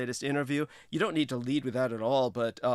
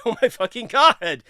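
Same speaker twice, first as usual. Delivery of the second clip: loud exclamation; 9 semitones higher, 12 dB louder than usual. Real, not acted. Laughing exclamation.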